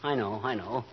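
husband softly apologizes to his teary wife; tender apology. Acted. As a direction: soothe her gently, sheepish. Husband comforts crying wife.

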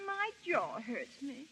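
very soft, low voices; quiet and hurt. Acted. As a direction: murmur it, nursing a sore jaw. Hurt jaw, quiet exchange.

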